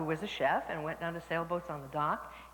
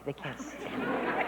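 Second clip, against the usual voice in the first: hushed aside; 3 semitones lower, much slower than usual. Acted. Aside about problem solvers.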